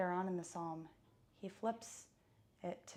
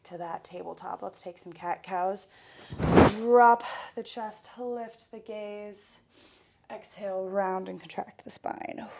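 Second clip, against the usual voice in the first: loud, fast workout coach; fitness instructor; 8 dB louder than usual. Acted. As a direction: pump up the class. Leads a workout warm-up.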